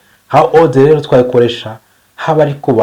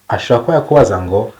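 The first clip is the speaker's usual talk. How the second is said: usual speaking voice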